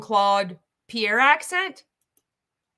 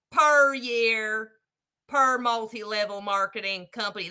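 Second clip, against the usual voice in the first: higher, hammed-up prospector rant; mock prospector, worked up; 4 semitones higher, 4 dB louder than usual. Prospector warning son about churn rates, hammed-up. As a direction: preach the numbers as a riled-up prospector.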